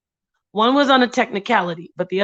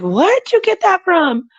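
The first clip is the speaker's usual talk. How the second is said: surprised parent; 10 semitones higher, 5 dB louder than usual. Retold or imitated. Parent quoted scolding child.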